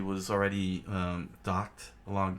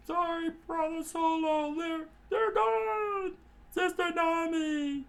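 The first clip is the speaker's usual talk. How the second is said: much higher, slow, pleading; high whiny apology; 3 dB louder, slower than usual. Acted. Johnny and Yosaku telling Solo Nami is gone, whiny. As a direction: wail out the bad news.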